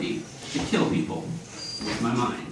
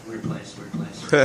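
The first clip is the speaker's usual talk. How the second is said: quiet aside; 9 dB quieter, slower than usual. Real, not acted. Quiet aside after joke.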